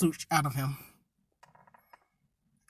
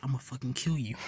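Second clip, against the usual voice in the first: low threat; 8 semitones lower, 9 dB quieter than usual. Retold or imitated. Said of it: "Bakugo's like".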